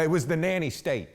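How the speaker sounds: ordinary talk, nothing marked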